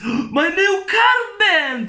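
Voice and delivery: high, slow, outraged landlady shriek; mock shriek. Landlady shrieking at her wet tenant, high and slow. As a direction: ham up the landlady's horror.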